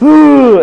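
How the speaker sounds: high loud startled ooh; Dustin's scared ooh. Retold or imitated. Imitating Dustin's scare, a loud high ooh. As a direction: yelp ooh like a spooked friend. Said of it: "He'll just be like".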